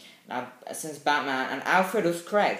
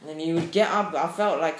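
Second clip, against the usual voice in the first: usual speaking voice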